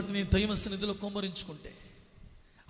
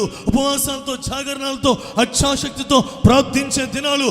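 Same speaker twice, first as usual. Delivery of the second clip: higher, louder sermon cry; fervent preaching; 4 semitones higher, 8 dB louder than usual. Acted. Preacher calling the church to prayer, full-force preaching. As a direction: pound out each line to the crowd.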